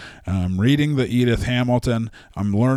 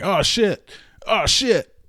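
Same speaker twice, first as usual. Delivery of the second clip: mock panic; 8 semitones higher, slower than usual. Zeus panicking about Hera, high hammed-up "oh shit". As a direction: squeal it out like a cartoon god caught cheating. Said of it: "just panicking and going".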